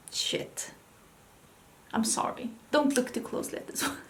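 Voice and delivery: hushed, nearly voiceless apology; whispered aside. Real, not acted. Vlogger excusing a product's messy look to viewers, whispered aside. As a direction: drop to an embarrassed whisper.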